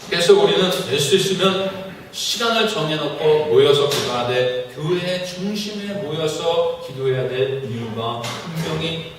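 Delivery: normal talking voice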